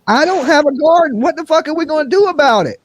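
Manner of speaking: high put-on voice; high squeaky mock comeback; mock reply. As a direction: fire it back in a squeaky voice. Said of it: "I was like".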